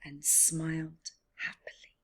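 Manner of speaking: soft, whispered close; whispered the end; hushed story ending. A narrator's reading. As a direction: wind the tale down gently.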